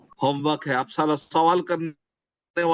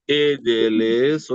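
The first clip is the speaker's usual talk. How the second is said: slow call; 4 semitones lower, much slower than usual. Shown as a demonstration. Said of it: "ऊपर से नीचे इशारा करना".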